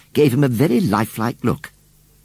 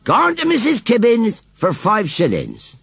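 high-pitched auction shout; 12 semitones higher, 3 dB louder than usual. A narrator's reading. Auctioneer interrupts the children with the sale, shouting. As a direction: call it out over the crowd. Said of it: "interrupted by a shout of".

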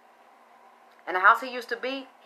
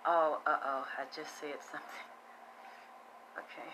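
hushed oops; 6 semitones lower, 14 dB quieter than usual. Real, not acted. Catches herself revealing too much.

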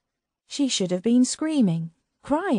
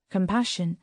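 plain reading voice

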